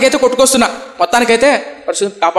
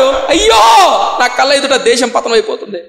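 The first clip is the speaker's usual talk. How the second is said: wailing cry; 7 semitones higher than usual. Retold or imitated. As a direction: cry out for the falling nation. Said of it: "అని ఏడుస్తున్నాడు".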